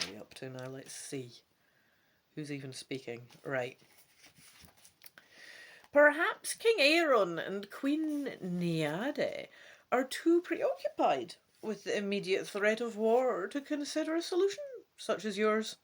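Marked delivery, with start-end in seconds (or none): 5.9-15.8 hoge, trage, gladde oudevrouwenstem; soepel, oude vrouw. Acted. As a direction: praat er glad overheen. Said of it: "Madame Ursula says smoothly".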